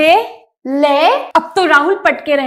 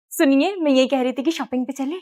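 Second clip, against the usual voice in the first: coaxing wife; 4 semitones lower, 8 dB quieter than usual. Acted. Sweet wife coaxes husband.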